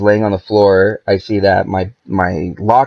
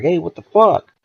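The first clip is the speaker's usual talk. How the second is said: mock startled protest, pitched up high; imitating startled neighbors; 8 semitones higher than usual. Startled neighbors reacting to a rifle aimed at them, mock alarm.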